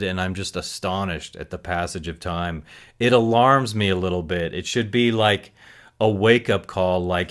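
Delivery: ordinary delivery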